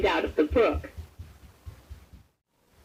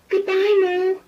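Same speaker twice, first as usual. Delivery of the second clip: high, louder farewell in a small kitten voice; kitten goodbye; 10 semitones higher, 5 dB louder than usual. A narrator's reading. Shy kitten calls goodbye to the mole, high and sweet. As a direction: call goodbye in a tiny voice.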